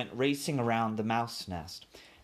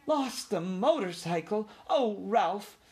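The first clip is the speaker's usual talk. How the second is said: high, alarmed cry; dismayed mother mouse, pitched up; 10 semitones higher than usual. A narrator's reading. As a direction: cry out in alarm at your son. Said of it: "cried his mother, genuinely a-, alarmed".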